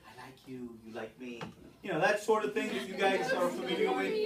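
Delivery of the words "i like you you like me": low, hushed aside; cozy mock affection. Teacher acting out Franklin's hoped-for mutual liking, low and hushed. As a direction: drop very low and soft. Voices an imagined mutual fondness.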